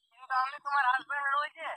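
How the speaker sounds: normal talking voice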